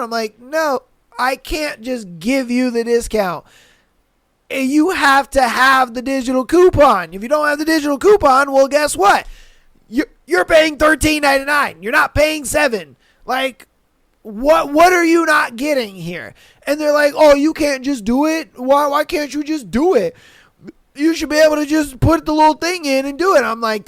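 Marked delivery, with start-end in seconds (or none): 0.4-16.3 loud, high exasperated lecturing. Retold or imitated. Retold angry reply to customer. 17.1-23.4 whiny customer. Retold or imitated. Mimicking demanding customer.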